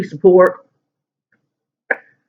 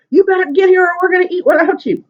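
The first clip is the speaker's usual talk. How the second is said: scolding mom; 14 semitones higher, faster than usual. Retold or imitated.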